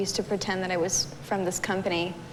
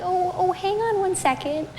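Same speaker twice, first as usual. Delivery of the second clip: imitating an old lady, higher and slow; lightly voiced sweet old lady; old woman on the phone; 11 semitones higher, 3 dB louder than usual. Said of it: "she's like".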